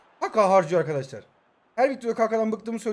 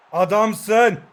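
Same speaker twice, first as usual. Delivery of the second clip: loud cheer of approval; jubilant praise; 7 dB louder than usual. Real, not acted. Player hails his man after a good play, jubilant. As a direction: shout your praise at the player.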